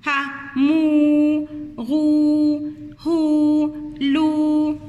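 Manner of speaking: بو، نو stretched with rounded lips; rounded long oo. Shown as a demonstration. Teacher showing children the rounded long oo. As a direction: purse the lips and hold the oo in بو. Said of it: "ضم بقك كده".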